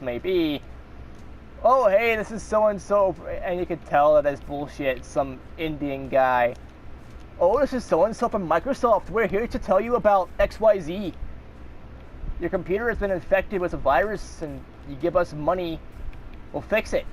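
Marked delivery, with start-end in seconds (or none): 1.6-3.1 high, bright fake caller greeting; scam caller voice. 7.4-17.1 put-on a weak, lightly done Indian accent. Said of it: "I can't really do a good Indian accent".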